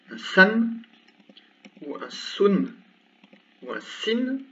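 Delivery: san, sun, sin each ending sounded out; tanwin endings demo. Shown as a demonstration. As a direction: pronounce each nunation ending clearly. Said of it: "on peut avoir". (terminaisons bien prononcées)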